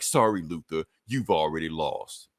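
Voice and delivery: a hint of a sinister AI; cold AI. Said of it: "He's like".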